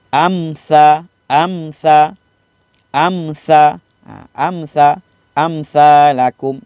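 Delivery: amsa repeated with a clear, undroned mim; tajwid demonstration. Shown as a demonstration. Ustaz drilling pupils on amsa, clear recitation. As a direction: say amsa with a clean closed mim. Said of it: "Mim mati".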